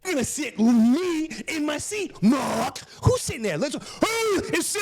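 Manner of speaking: high, strained indignant demand; outraged rant. Retold or imitated. Passenger challenges Mark over the seat, outraged. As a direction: act out a passenger ranting at staff.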